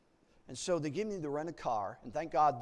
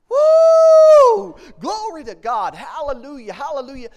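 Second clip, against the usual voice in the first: high, loud joyous praise; exultant shout; 12 semitones higher, 25 dB louder, slower than usual. Retold or imitated. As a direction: shout for joy to God.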